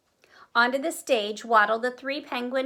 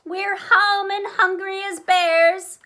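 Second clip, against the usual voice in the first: slow, loud, higher announcement; announcing loudly; 9 semitones higher, 7 dB louder, slower than usual. Acted. Bears marching on stage announce their arrival loudly. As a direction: boom it out to the audience, slowly. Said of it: "announced the three bears".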